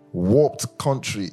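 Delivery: ordinary talk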